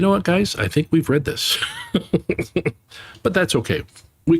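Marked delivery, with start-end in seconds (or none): 1.6-2.7 brief high laugh; amused laugh at rereading. Real, not acted. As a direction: laugh at herself.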